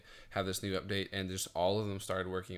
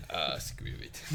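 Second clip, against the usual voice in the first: croaky parody whisper; 6 semitones lower, slower than usual. Retold or imitated. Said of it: "parodied that".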